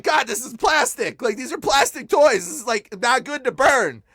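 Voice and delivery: high, loud objection to God; re-enacted incredulous complaint; retold protest. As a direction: argue back, stretching the key words.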